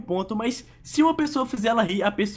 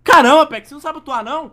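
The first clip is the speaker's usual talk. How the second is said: mock angry outburst; 6 semitones higher, 8 dB louder than usual. Staged fake argument begins.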